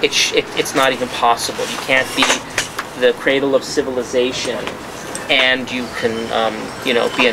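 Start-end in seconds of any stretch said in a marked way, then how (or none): none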